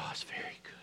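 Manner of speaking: barely audible approving whisper; whispered God's voice. Retold or imitated. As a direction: hush it like a loving secret. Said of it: "he said".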